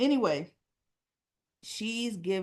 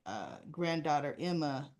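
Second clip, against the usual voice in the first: ordinary delivery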